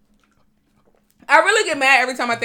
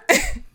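incredulous laugh. Real, not acted. Laughs mid-rant at the driver.

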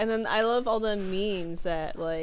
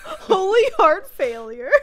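laughing through it; 11 semitones higher, 9 dB louder, slower than usual. Real, not acted. Reading absurd lines, laughing.

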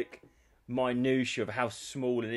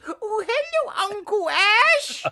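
put-on very high, slow little-girl coo; high drawn-out childish greeting; 9 dB louder, much slower than usual.

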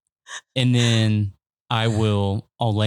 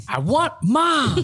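put-on high, slow, pleading child voice; whiny little girl; 13 semitones higher, slower than usual. Dad imitating his daughter whining at night, high and slow. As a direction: imitate a sleepy toddler wanting mom. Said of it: "And she's like".

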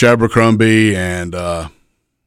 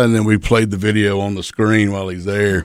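ordinary talk

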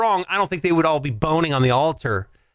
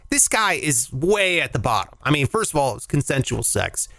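ordinary delivery

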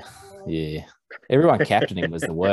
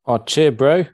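higher, louder Kiwi greeting; jokey Kiwi accent; 8 semitones higher, 6 dB louder, slower than usual. Pretend. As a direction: play up the Kiwi slang. Jokey Kiwi greeting between podcast mates.

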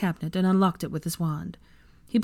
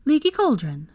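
higher-pitched call; 8 semitones higher, 4 dB louder than usual. A narrator's reading. Luna calling into the fireplace, calling out. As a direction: call out the destination. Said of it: "She called out".

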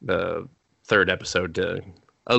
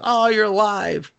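mock relieved surprise; 11 semitones higher, 3 dB louder than usual. Voices Claire relieved.

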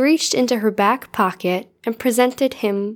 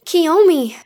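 loud, high call of her name; crying out a name; 8 semitones higher, 5 dB louder than usual. Acted. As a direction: call out to her across the lobby. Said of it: "Yukimura cried".

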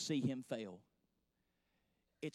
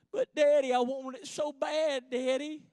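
put-on high, louder child's whine; pleading little girl; 7 semitones higher, 3 dB louder than usual. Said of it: "She says".

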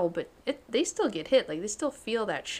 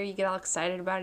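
ordinary talk

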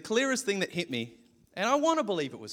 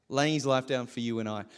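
ordinary talk

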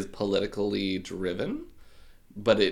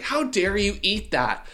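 higher, slow, louder mock scolding; hammed-up furious mother; outraged mom; 9 semitones higher, 4 dB louder, slower than usual. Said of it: "And she's like".

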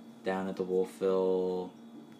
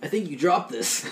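nice surfer guy; 7 semitones higher, 3 dB louder than usual. Acted. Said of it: "play someone like that who wasn't an idiot".

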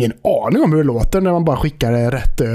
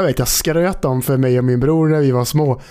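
usual speaking voice

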